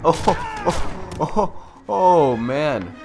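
ordinary talk, nothing marked

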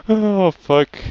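high shocked gasp and curse; jump-scared, cursing; startled gasp. Real, not acted. Startled by noise.

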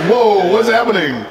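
slow, amused mock alarm; mock surprise. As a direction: play the body's startled reaction. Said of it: "my body's like".

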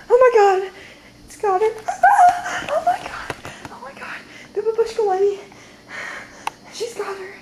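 panicked. Acted. Friend taken by babushka.

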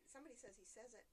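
usual speaking voice

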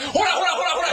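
high, laughing "look look"; gleeful jeering; excited pointing. Retold or imitated. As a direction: point and crow with glee.

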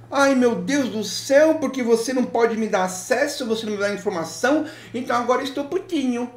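high, whimpering mock crybaby voice; whiny offended client. Mocking the offended client who wants free help, high whiny crybaby voice. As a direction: play the client as a sulking, teary baby, hammed-up. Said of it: "Aí ficou ofendidinho".

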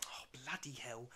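slow, soft whispered curse; exasperated whisper before jumping off the train; weary whisper. Retold or imitated. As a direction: mutter it in resignation. She must jump after him.

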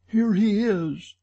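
high-pitched, urgent cry; Christine crying out. A narrator's reading. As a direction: call it out high and alarmed. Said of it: "cried Christine".

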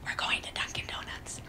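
very quiet, voiceless whisper; whispered practice phrase for a child. Shown as a demonstration. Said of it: "Whisper, say".

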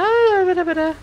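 high, loud begging; eager pleading. Real, not acted. Begging the boss to die.

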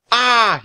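high, loud scream; terrified yell. A narrator's reading. Teddy seeing the floating bone, frightened yell. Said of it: "yelled Teddy".

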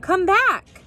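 high, loud call to a runaway cat; urgent calling out. A narrator's reading. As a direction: call the escaping cat back. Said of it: "Mister Sims called out".

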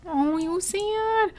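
high, slow pitying coo; stretched-out baby-talk pity; baby-talk sympathy. Pretend. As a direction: coo at a sad toddler. Cooing at sad toddler in game.

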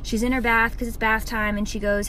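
ordinary delivery

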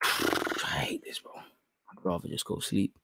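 very soft, slow, half-whispered grumble; fed up, almost whispering; dejected mumble. Real, not acted. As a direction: mumble it wearily under your breath. Dejected aside while stream fails.

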